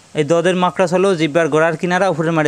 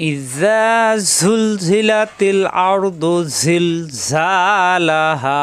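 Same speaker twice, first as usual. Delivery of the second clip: tajweed demo; much slower than usual. Shown as a demonstration. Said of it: "আজকে ক্লাসে শিখাবো সূরা আল জিলজাল".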